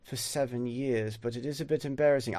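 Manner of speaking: soft London-leaning British accent; understated Cockney-tinged British speech. Shown as a demonstration. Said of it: "I've spoken in the British accent".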